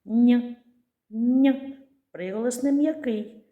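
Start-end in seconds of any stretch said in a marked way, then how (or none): 0.1-1.7 a loud ringing н held alone; isolated consonant sound. Shown as a demonstration. Teacher isolating the sound н for the class. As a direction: sound out н clearly for children. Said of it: "Другий звук".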